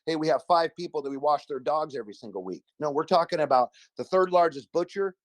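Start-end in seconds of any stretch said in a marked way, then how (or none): none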